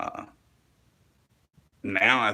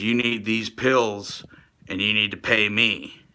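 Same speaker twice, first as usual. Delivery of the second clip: usual speaking voice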